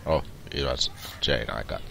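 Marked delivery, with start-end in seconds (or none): none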